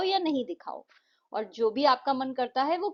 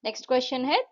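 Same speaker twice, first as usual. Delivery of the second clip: normal talking voice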